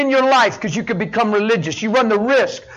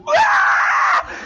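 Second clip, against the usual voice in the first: retold blubbering cry. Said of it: "I'm a blubbering mess".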